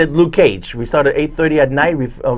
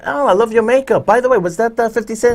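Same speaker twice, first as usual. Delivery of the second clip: lightly voiced actress, higher pitch; a bubbly co-star gushing, pitched up; imitating the actress; 7 semitones higher than usual. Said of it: "she walked in and said".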